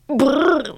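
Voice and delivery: high lory brrr, a small shiver. Acted. The cold lory interrupts the mouse with a shiver. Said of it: "fit en frissonnant le lori".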